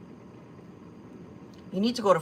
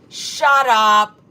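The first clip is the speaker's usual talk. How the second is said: louder, stunned shout of shut up; loud disbelieving shout; 9 dB louder than usual. Real, not acted. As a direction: blurt it out in real disbelief. Reacting to shocking chat news.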